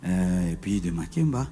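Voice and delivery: hushed relaxed reply; gentle home voice. Shown as a demonstration. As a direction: answer mildly and quietly. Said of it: "C'est comme ça que je parle".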